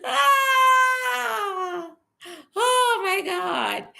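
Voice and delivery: startled cry. Real, not acted. Sudden cry at the stream.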